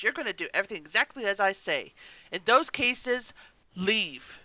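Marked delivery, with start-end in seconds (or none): none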